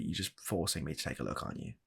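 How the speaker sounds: usual speaking voice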